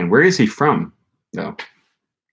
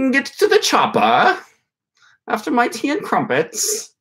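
much higher, hammed-up posh British accent; British accent impression; 14 semitones higher than usual. Imagining a proper English guy sipping tea, hammed-up British accent. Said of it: "my impression of a British guy".

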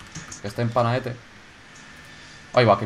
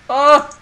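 short, high, loud yelp; startled yelp; 13 semitones higher, 10 dB louder than usual. Real, not acted. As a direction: cry out in sudden alarm. Sudden scare in the game.

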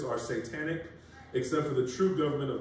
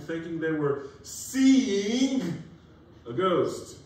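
louder, slow preaching read; dramatic scripture reading; 5 semitones higher, 5 dB louder than usual. Acted. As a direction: build it like a sermon.

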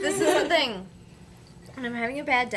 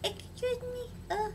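timid idol voice; 11 semitones higher than usual. Pretend. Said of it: "She would be like".

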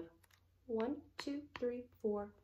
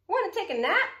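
high, loud storybook cat; cat's invitation; 10 semitones higher, 17 dB louder than usual. A narrator's reading. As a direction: ask as the cat, pitched up. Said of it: "cried the cat".

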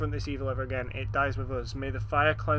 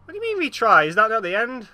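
high, loud disbelieving outburst; indignant shock; 7 semitones higher, 7 dB louder, faster than usual. Real, not acted. Player shocked at the retry screen, loudly protesting. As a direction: protest the game over in disbelief.

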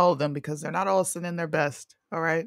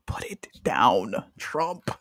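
whispered plea; 7 semitones lower, much slower than usual. Pretend.

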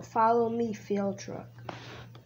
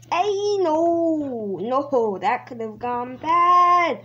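loud, drawn-out protest; alarmed outcry; 2 semitones higher, 4 dB louder, slower than usual. Real, not acted. Player after nearly crashing, loud drawn-out alarm. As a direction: cry out at a near miss.

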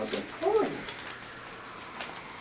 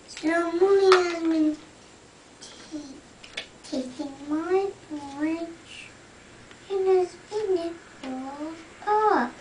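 higher, wailing little bear; little wee bear crying; 6 semitones higher, slower than usual. Acted. Reading to a child, the little bear cries over the empty bowl. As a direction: whimper then wail it out. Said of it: "cried the little wee bear".

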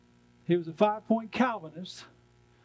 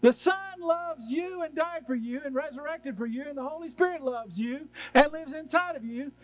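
tender reassurance; 7 semitones higher than usual. Real, not acted. Tender reassurance after rejecting fear.

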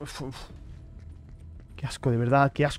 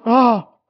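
short, louder startled cry; startled yelp; 5 semitones higher, 8 dB louder than usual. Real, not acted. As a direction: jump at the scare out loud. Jumpscare in horror game.

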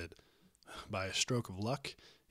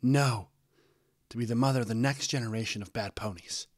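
loud, emphatic rebuttal; forceful shouted correction, loudest at the start; yelling; 2 semitones higher, 7 dB louder than usual. A narrator's reading. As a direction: yell back in an argument. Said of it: "her mother yelled".

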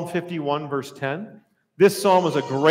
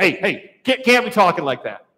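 louder acted reprimand; calling it out; 6 dB louder than usual. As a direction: cut in and call someone out firmly. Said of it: "you're gonna call that out".

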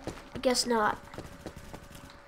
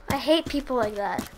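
ordinary delivery

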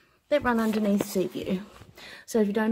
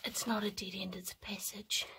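whispering; 13 dB quieter than usual. Real, not acted. Creeping through the dead tunnel.

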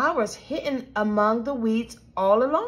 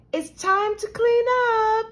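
high, sing-out call; mother calling out; 10 semitones higher, 3 dB louder than usual. A narrator's reading. Mom calling children to clean up, high carrying call. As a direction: sing it out from the doorway, pitched high.

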